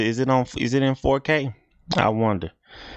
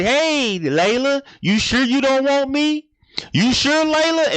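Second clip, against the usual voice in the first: very high, put-on coaxing voice; high-pitched mock goddess, checking if she's sure; hippopotamus god. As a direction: imitate a goddess calling out an offer, way up high. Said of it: "the hippopotamus god came and spoke to her".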